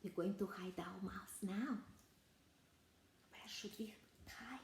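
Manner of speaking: put-on secret whisper to the children. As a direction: whisper so nobody hears. Hiding mouse from kids.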